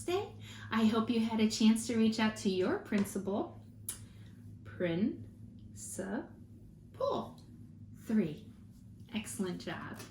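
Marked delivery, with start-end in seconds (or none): 4.6-6.3 slow, syllable by syllable; split into syllables. Shown as a demonstration. Teacher modelling syllables for the class, slow and chopped. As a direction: chop each syllable out slowly. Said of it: "last one ... is principal".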